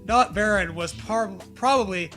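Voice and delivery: usual speaking voice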